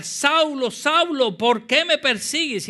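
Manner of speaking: loud, high-pitched divine call; preacher booming out God's voice; voice from heaven. Acted. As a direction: cry it out as a thundering summons. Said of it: "una voz que le decía".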